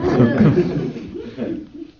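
a loud high laugh at his own joke; high burst of laughter. Real, not acted. Lecturer laughing at his own joke, loud high laugh.

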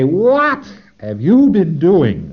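a deliberate probing ask; slow pointed question. Shown as a demonstration. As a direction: ask it slowly and pointedly, leaning on you. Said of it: "We say".

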